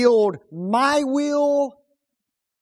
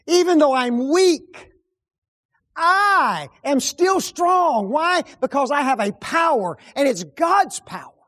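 preaching at full force; 7 semitones higher, 6 dB louder than usual. Real, not acted. Preacher proclaiming God's power to the congregation, loud and triumphant. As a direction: build it to a triumphant cry.

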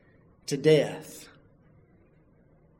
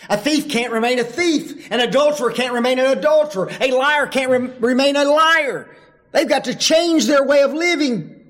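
louder, rising preaching voice; 8 semitones higher, 4 dB louder than usual. Acted. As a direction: drive the list home from the pulpit. Preacher building to full force.

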